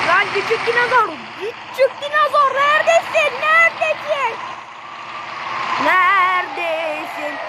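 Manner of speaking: high sing-song call to a little dinosaur. Acted. Gamer calling out to hidden dinosaur, high sing-song.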